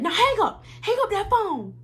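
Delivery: high, bossy imitation of a sergeant's order; mock drill sergeant command. Drill sergeant telling the recruit to end the call, mock command. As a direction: snap the order like a mocked sergeant. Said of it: "And he said".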